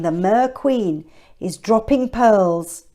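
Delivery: usual speaking voice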